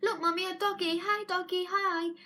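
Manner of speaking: put-on high, childlike chirp; little kid voice. Small child excitedly pointing out a doggy to mum, high child voice. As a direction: play a small child spotting a dog. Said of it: "maybe some kids might go".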